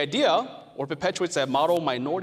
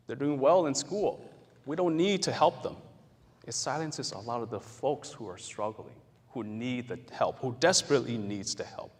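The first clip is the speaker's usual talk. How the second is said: normal talking voice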